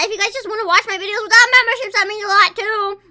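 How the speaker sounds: high, loud, fast plug; excited. Real, not acted. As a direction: hype it up. Hyped plug.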